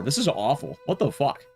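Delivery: ordinary talk, nothing marked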